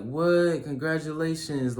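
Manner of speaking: loud, high burst of delighted surprise; excited congratulations. Acted. Real supporter lifting a friend up, excited. Acting a proud supporter's reaction.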